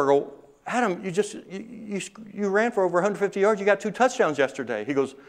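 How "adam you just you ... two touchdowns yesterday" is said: amazed. Retold or imitated. Teacher amazed at Adam.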